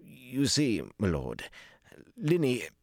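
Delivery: high, halting start; nervous, broken-up explanation; awkward hesitation. A narrator's reading. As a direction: stall before breaking awkward news. Stalling over awkward news.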